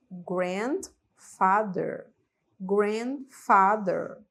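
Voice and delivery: "grandfather" said very slowly, every syllable drawn out; slow model pronunciation. Shown as a demonstration. Teacher slowly modeling "grandfather" for students to repeat. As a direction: stretch out "grandfather" for learners to copy. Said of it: "Aproveitem pra repetir comigo".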